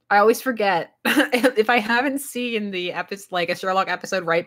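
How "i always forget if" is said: ordinary talk, nothing marked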